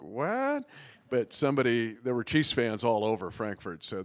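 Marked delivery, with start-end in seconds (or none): 0.0-0.6 shocked high-pitched What; surprised what. Retold or imitated. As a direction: jump up in disbelief. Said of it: "I'm like".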